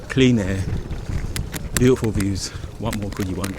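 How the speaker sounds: ordinary delivery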